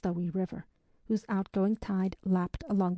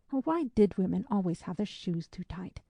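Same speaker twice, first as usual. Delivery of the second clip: ordinary delivery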